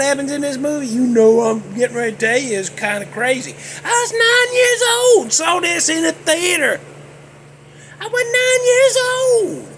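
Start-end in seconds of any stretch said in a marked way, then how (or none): 3.8-9.6 high, indignant squawk; high-pitched outrage. Real, not acted. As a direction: exclaim it way up high, drawing out the last word. Outraged childhood memory, exclaimed.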